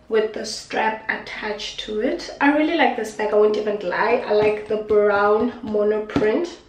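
ordinary delivery

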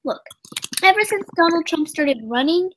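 ordinary delivery

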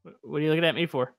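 ordinary delivery

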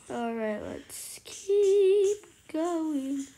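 ordinary delivery

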